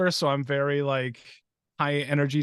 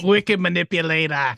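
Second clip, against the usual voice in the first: attempted Boston accent that slides toward Arnold; botched Boston accent; 4 dB louder than usual. Shown as a demonstration. Host trying the card name for his co-host, botched Boston accent. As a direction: have a go at Boston and miss. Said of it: "No, no, we have Arnold".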